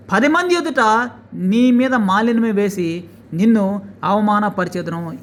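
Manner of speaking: loud, slow, declaiming; thundering scripture reading. Acted. Preacher reading a prophecy of shame to viewers, loud and slow. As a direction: hurl the prophecy out word by word.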